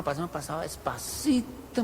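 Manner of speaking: soft, hushed telling; careful creeping. Retold or imitated. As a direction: say it gingerly, as if creeping through.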